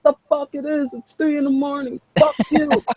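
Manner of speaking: high, indignant and laughing; angry neighbor. Acted. Neighbor woken at three am telling the kinfolk off, high and laughing. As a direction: yell back, high, cracking up.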